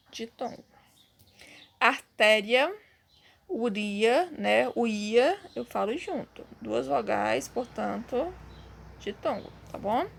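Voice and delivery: ordinary talk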